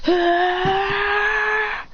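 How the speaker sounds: high crying ah; high anime effort cry; high strained yell. Acted. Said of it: "Then he goes".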